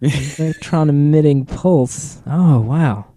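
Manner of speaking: dry mock amazement; slow, fake-impressed tone; sarcastic. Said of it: "I can't tell if you're being sarcastic".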